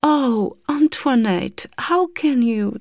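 high, slow, chirping English lament; saddened twittering. Acted. Governess gently reproaching the girl, chirping sadly. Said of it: "avec une sorte de gazouillement attristé".

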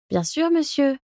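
murmured reply. A narrator's reading. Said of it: "murmurai-je".

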